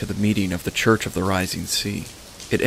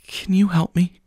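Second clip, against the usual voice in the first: high, shaky pleading after crying; tearful plea; 10 semitones higher than usual. Acted. Grieving mother begs the pastor, tearful and high. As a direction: beg for help through tears. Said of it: "he saw she had been crying".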